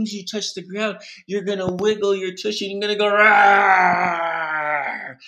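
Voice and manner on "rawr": loud, drawn-out roar; demonstrated bear roar. Shown as a demonstration. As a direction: roar long like a forest bear. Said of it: "like you're a bear in a forest".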